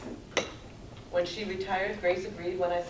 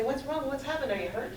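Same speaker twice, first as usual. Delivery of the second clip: higher-pitched, concerned questions; imitating alarmed concern; retold worried husband; 7 semitones higher than usual. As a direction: ask anxiously, as a worried husband would. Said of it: "he would hear me crying and he'd say".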